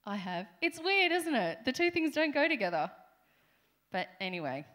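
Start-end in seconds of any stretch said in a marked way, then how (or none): 0.6-2.9 much higher and louder, quick amused disbelief; incredulous exclaim. Real, not acted. Preacher to congregation about the phrase holy hell, loud amused disbelief. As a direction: throw it out to the room, laughing at the oddness.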